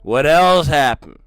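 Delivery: suspicious husband grilling his wife, rising to loud; jealous husband. Retold or imitated. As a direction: voice the husband catching her out. Husband's jealous questioning voiced.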